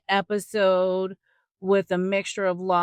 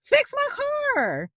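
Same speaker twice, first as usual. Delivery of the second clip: mock demand. Car owner to the driver who hit her, high indignant demand. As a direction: insist they pay up. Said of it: "No, you're like".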